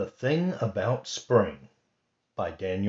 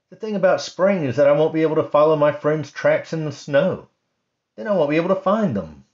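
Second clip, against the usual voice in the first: quick, higher complaining bunny voice; grumpy Rabbit; 7 semitones higher, 8 dB louder, faster than usual. Acted. Rabbit complaining to Bird and Mouse, lightly voiced grumpy bunny. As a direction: rattle off gripes like a sulky rabbit. Rabbit grumbles about spring.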